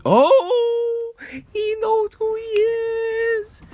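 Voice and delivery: slow squeaky falsetto tease; high falsetto. Pretend. Teasing about a mystery guy.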